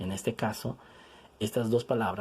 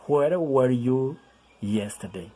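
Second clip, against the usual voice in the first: very slow "Where were you", with "Where" louder; slow model reading; 3 semitones higher, 5 dB louder, slower than usual. Shown as a demonstration. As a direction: spell the words out slowly. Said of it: "Uno es where y el otro es where".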